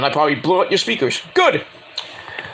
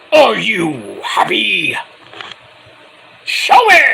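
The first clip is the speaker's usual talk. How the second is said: slow build to shout; 2 semitones higher, 4 dB louder, slower than usual. Retold or imitated. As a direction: drag out the question, then yell the demand. Darby challenging the champ.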